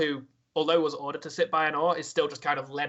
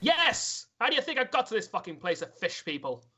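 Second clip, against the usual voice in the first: high-pitched, exasperated captive sailor; indignant retort; 7 semitones higher than usual. Acted. Sailor answering the man who questions him, indignant. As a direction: fire back as if the question is stupid.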